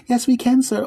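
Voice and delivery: very high, firm clerk voice; desk lady. Acted. Gatekeeper lady refusing the pleading man. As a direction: shut him down politely.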